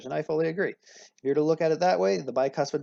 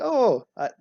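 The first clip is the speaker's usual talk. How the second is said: higher, louder, badly faked Canadian "oh"; mock Canadian accent; 9 semitones higher, 5 dB louder than usual. Teacher imitating two Canadians in discussion, mock Canadian accent. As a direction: put on a terrible Canadian accent. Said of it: "forgive me for my terrible accent".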